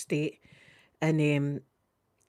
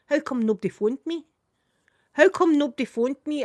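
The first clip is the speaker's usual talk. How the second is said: higher, rising indignation; building outrage, louder on the repeat; indignant; 6 semitones higher, 4 dB louder than usual. Retold or imitated. Realising no one called her, indignant. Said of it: "And I'm thinking".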